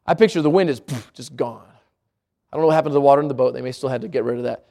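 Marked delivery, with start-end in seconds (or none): 0.8-1.7 hushed poof. Real, not acted. Wind vanishing image.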